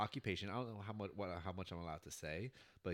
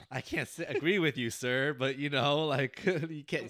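laughing reply; 5 semitones higher, 9 dB louder, slower than usual. Retold or imitated. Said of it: "And I was like".

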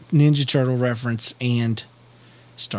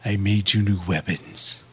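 low, slow and smooth; slow smooth tough voice; 5 semitones lower, 4 dB quieter than usual. Acted. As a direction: lay it on slow and cool. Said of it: "more breathy".

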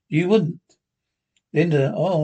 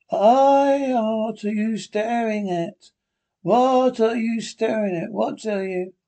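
higher, slow, fearful questions; frightened; 5 semitones higher, slower than usual. Acted. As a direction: stare at her in growing fear. John sees Linda changing.

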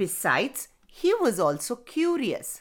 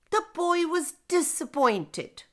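ordinary delivery, nothing marked

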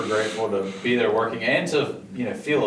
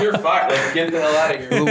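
a half-joking boss shout, higher and louder; mock firing yell; 5 semitones higher, 5 dB louder than usual. Boss telling staff who slip up to go home, mock yell. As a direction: laugh while barking the staff out.

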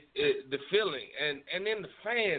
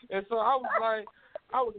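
usual speaking voice

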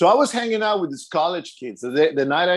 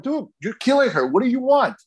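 high, incredulous challenge, retold; confronting the guy who was choking her; shocked protest; 7 semitones higher than usual.

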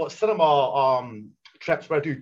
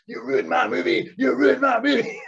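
retold shout; 7 semitones higher, 4 dB louder, slower than usual. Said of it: "screaming in his face and shouting".

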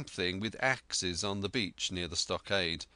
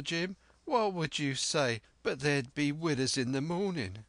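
put-on high, eager old castaway voice; an excitable marooned sailor rambling on; Ben Gunn; 4 semitones higher than usual. Marooned sailor telling Jim of Flint, eager and high.

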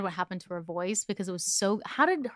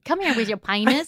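mock Texas twang; 3 semitones higher, 4 dB louder than usual. Imitating her friend's Texas twang, playful. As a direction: tease in a mild Texas twang. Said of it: "Is that how you used to talk?".

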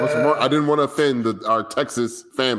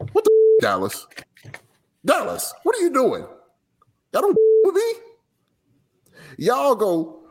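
high-pitched, exasperated yell of a performer; mock yelling. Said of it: "yelling at people".

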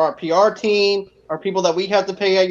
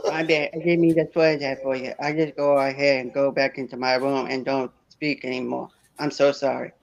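soft, timid goofy mumble; meek apologetic underdog voice, softer; Bobby Boucher voice; 4 semitones lower, 6 dB quieter than usual. Acted. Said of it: "That should be the Motel Geek voice".

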